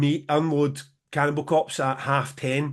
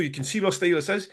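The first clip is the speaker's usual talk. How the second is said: normal talking voice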